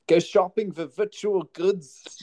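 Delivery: put-on Scottish accent. Reading the article in a Scottish accent as requested. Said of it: "That was my best Scottish".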